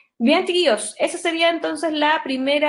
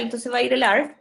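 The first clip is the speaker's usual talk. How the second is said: normal talking voice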